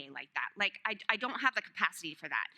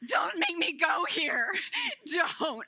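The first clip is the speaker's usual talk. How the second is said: put-on whiny child; 6 semitones higher, 4 dB louder, slower than usual. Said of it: "Eden's still holding onto the door".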